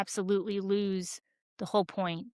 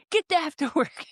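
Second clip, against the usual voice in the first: mock trainer hype, higher and louder; imitating a personal trainer's high-pitched hype, laughing; 8 semitones higher, 4 dB louder than usual. A personal trainer firing up his clients, imitated in a high-pitched voice. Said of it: "he goes into this high-pitched".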